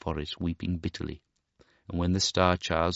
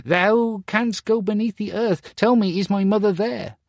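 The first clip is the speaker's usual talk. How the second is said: much higher, louder asking; pleading boy; 14 semitones higher, 5 dB louder than usual. Acted. Star Child asks the mole for his mother, pleading. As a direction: beg the mole for news.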